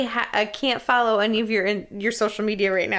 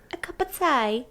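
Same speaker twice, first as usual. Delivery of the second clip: mock British accent; 5 semitones higher, 3 dB quieter than usual. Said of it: "in a British accent".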